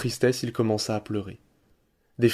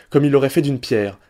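plain reading voice

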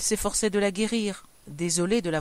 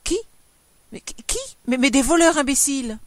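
stammer, then burst out loud; high, loud outburst after halting start; shuddering alarm; 6 semitones higher, 7 dB louder than usual. A narrator's reading. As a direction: sputter in fearful exasperation. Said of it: "Elle frémissait".

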